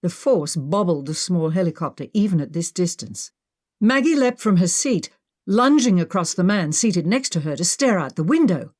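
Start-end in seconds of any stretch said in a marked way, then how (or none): none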